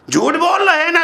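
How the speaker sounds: high, forceful charge; thundered accusation. Real, not acted. Preacher accusing the congregation of lying, at full force. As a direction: hurl the accusation out.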